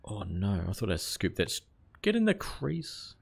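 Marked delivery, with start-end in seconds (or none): 2.0-3.1 urgent urging. Real, not acted. Urging batter in cricket game.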